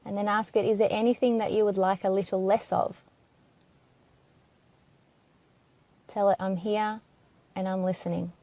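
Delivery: hushed, even inquiry with long silences; guided meditation. Acted. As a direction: gently put each question and leave room for an answer.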